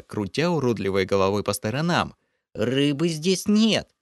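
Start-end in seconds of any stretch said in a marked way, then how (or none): 2.5-3.9 high, slow pleading creature voice; panicked creature denial. Acted. Lokhar insists to the hero, high whining. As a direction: whine it like a scared little goblin.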